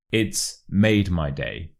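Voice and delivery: slow "It's", then strong "made my" joined; slow model phrase, "made" prominent; stress on MADE. Shown as a demonstration. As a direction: lean on "made", running it into "my". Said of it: "stress the word made".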